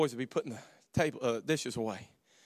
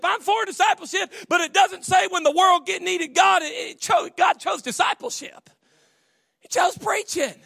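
shouted sermon line; 10 dB louder than usual. Acted. Preaching at full force.

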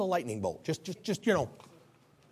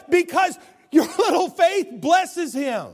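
laughing, much higher and louder shout; joyful preaching shout; 12 semitones higher, 8 dB louder, slower than usual. Real, not acted. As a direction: burst out with joy over their faith.

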